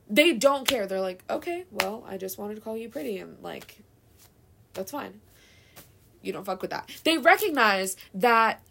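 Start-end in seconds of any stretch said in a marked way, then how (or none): 1.3-6.9 calm shrug. Retold or imitated. Voicing calm mature man.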